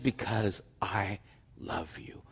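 tender whisper. Acted. Sincere worship voiced.

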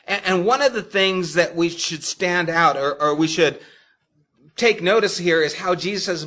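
normal talking voice

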